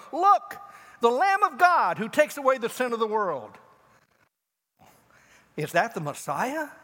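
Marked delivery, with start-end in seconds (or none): 0.1-3.6 high, loud proclamation; John the Baptist proclaiming. Retold or imitated. Baptist pointing Jesus out, loud proclaiming cry. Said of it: "point at Jesus one day and go".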